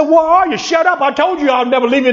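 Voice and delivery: high, excited scolding retort; worked-up, high-pitched comic rebuke; mock exasperation. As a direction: play an exasperated answer to a doubter. Acts God's exasperated reply to doubters.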